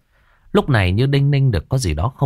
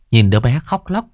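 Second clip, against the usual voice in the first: ordinary delivery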